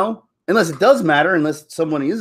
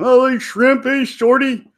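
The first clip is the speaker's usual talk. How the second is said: high-pitched taunting call-out; playful jeer, pitched up; mock teasing call; 10 semitones higher, 3 dB louder, slower than usual. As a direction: imitate kids hailing someone with a nickname. Imitating childhood name-callers.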